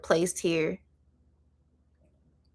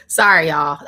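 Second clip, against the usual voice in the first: laughing; 9 dB louder than usual. Real, not acted. Streamer laughing at herself while apologizing to viewers, laughing. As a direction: laugh it off at yourself. Laughing at her own channeling.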